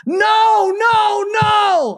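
high, loud mock protest shout; imitated yell. Son yelling at a penalty call, in a high loud shout. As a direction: yell no at the TV like a fan. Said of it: "he'll be like".

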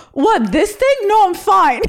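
high-pitched, overdone brush-off; feigned nonchalance. Retold or imitated. Bleeding girl hiding pain from her crush, overly breezy and high. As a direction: squeak out a fake reassurance.

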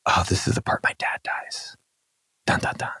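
hushed, ominous stage whisper, played for laughs; breathy whisper with a mock doom sting; mock-dramatic whisper. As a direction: whisper it like a spoof suspense reveal. Mock-dramatic reading of audiobook part.

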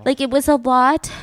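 ordinary talk, nothing marked

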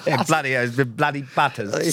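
put-on a mild send-up of an upper-class English accent; posh accent. Mocking posh slang among mates, in a posh English accent. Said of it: "You did a good posh accent".

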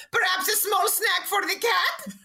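very high, slow put-on voice; slow, high-pitched questioning lilt, hammed up; imitated salesman. Salesman offers an ice cream customer a cat snack, high mock voice.

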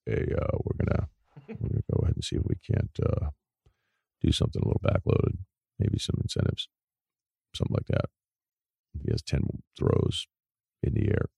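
low, soft coach's mumble; Bill. Acted. Coach floating a contract plan to his player, in a quiet mumble. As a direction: mumble it quietly with hardly any voice. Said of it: "I don't think Bill would say straight up".

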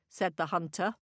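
ordinary delivery

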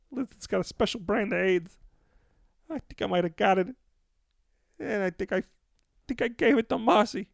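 high, strained little guy voice; squeaky sheepish lizard-man confessing; [name]'s caller voice. Acted.

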